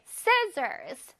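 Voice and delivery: Scissors with SCI- high, then falling; stressed first syllable. Shown as a demonstration. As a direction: hit SCI- hard in Scissors, relax the ending. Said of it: "C 부분을 아주 강조를 해야 돼". (Scissors의 첫 음절을 높게 강조)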